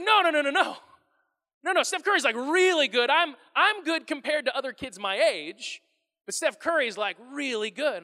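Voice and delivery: put-on a boy hurrying to correct his dad; protesting son. Pastor voicing his son arguing about Steph Curry, insistent.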